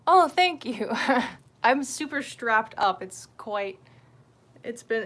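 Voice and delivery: normal talking voice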